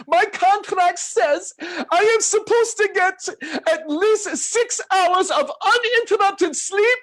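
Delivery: imitating George's complaint. Mimicking George demanding sleep, showers and meals, high and whiny. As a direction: insist on your rights in a high aggrieved whine. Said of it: "He says".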